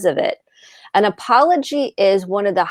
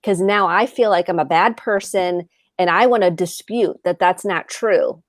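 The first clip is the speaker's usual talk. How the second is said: ordinary talk, nothing marked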